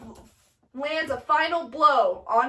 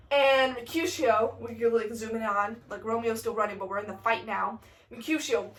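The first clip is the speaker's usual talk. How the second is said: normal talking voice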